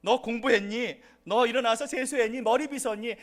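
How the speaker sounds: a touch of a mother's scolding checklist; nagging parent. Retold or imitated. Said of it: "이러한 잔소리를". (가볍게 흉내 낸 잔소리하는 부모)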